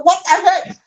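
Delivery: ordinary talk, nothing marked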